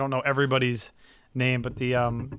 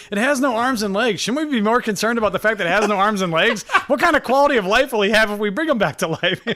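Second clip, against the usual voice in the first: Michael Scott quote; 6 semitones higher, 4 dB louder than usual. Retold or imitated. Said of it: "Michael Scott's like".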